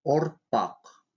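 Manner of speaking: Ортак with a short first vowel between O and U; short hard vowel. Shown as a demonstration. Teacher showing a short lip vowel, Ортак between O and U. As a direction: say Ортак briefly rounding the first vowel, tongue back. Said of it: "Твёрдый губной звук произносится кратко".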